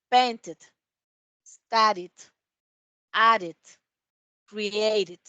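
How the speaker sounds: painted, studied, added, created each said with the final "id" syllable; model pronunciation. Shown as a demonstration. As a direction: model the words slowly, sounding the "id" ending. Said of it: "como si esto fuera una I". (cada palabra modelada, con el final "id")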